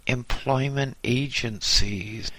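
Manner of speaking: ordinary delivery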